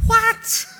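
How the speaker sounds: very high, loud squawk of bafflement; hammed-up incredulous husband; mock disbelief. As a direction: play it utterly dumbfounded. Husband baffled by wife's request.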